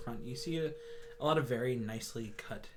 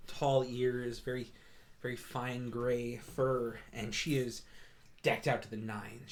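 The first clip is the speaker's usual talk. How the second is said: ordinary talk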